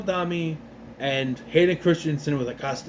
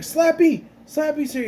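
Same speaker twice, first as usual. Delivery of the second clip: higher, louder alarmed yell; frightened yelling; 11 semitones higher, 4 dB louder than usual. Retold or imitated. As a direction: cry out in alarm that the doll is here. Frightened girl calls out alarm.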